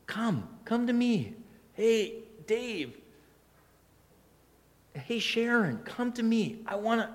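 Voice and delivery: gentle voice of God. Acted. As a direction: coax them close like a loving shepherd. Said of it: "And God's saying".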